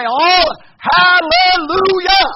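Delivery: "hallelujah" stretched syllable by syllable, high and loud; laboriously long shout. Shown as a demonstration. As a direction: drag out every syllable of "hallelujah" at full volume. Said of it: "It's so long to start with".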